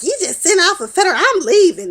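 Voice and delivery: mock-alarmed Angela, fast and loud; alarmed outburst. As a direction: blurt it out in alarm and storm off. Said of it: "she was like".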